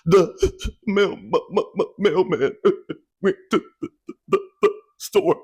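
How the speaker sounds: mock crying stutter dissolving into laughter; stutter through laughter. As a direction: laugh while stammering the line. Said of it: "stuttering is mixed in with the tears".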